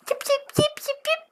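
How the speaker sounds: very high yips; peeping baby chick. Acted. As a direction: yip like tiny chicks.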